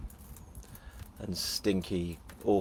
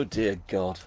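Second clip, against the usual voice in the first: normal talking voice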